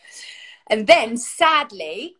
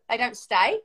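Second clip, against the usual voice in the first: ordinary talk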